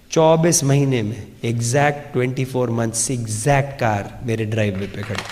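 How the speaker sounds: low, soft dramatic reveal; quiet, awed punchline; hushed reveal. Real, not acted. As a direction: drop the voice for the big payoff.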